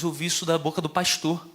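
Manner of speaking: ordinary talk, nothing marked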